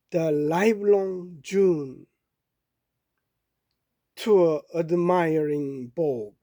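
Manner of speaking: very slow, measured English verse reading; poem recitation. Acted. Reading a Dickinson poem to listeners, slow recitation. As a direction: recite slowly, pausing between phrases.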